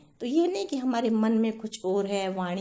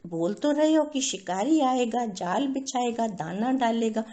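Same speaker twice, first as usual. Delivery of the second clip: raised, louder scolding; 3 semitones higher, 4 dB louder than usual. Acted. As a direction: tell the parrots off sharply. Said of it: "डांट लगाई".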